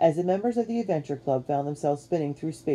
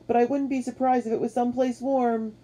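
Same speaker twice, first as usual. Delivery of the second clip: high, raised reply; calling back; 9 semitones higher than usual. A narrator's reading. Q answering Matt in the spinning boat, calling back. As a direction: keep calling over the noise. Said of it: "Q called back".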